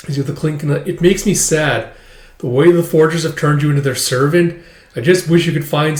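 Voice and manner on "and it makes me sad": plain reading voice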